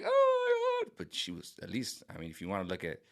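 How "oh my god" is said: very high shrieky mocking oh my God; mock shocked gasp. Vlogger mocking Tulsi Gabbard's reaction, high shriek. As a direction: mimic a startled shriek. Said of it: "Even Tulsi Gabbard was like".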